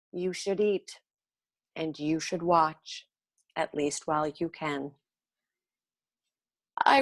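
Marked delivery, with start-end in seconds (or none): 0.1-4.9 solemn father. A narrator's reading. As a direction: speak gravely and quietly, like a father breaking sad news. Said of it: "with a solemn voice said".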